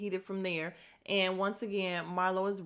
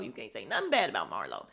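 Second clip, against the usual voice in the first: much lower, fast, mock-stern warning; mock Sonya; 10 semitones lower, faster than usual. Mimicking Sonya defending Marlo, low mock warning. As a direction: drop deep and warn, lightly mocking. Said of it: "all team Marlo".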